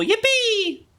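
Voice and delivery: very high, squealed yippee; held high squeal of glee; mock-gleeful cheer. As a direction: let out a silly high-pitched hooray. Cheers at becoming Corporal in game.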